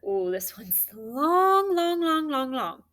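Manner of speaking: amazed at length. Real, not acted. Teacher marvels at long word.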